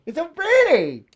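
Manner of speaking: high-pitched mock squeal of delight; hammed-up awe. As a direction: gush over it, voice leaping way up. Hammed-up marvelling at fire.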